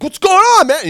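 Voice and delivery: high, loud holler of hello; loud greeting. Acted. Butterfly greeting anybody he sees, loud holler.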